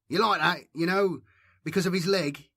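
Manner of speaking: high taunting schoolboy voice; mocking bully. A narrator's reading. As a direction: jeer at the old man's leg. Craven mocking the limp.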